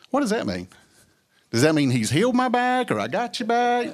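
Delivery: high, bewildered questioning; puzzled wondering. Retold or imitated. Himself, puzzling over what God just told him, high and bewildered. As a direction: ask it with a high puzzled pitch.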